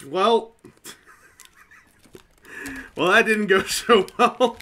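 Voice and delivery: loud, high laugh through words. Real, not acted. Laughs at own failure.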